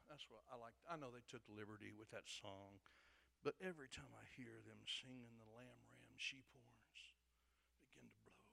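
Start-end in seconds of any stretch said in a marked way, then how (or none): none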